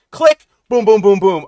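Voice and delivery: a sharp high click, then loud booms; game sound effects. Retold or imitated. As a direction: punch out the fight noises like a video game. Acting out game combat sounds.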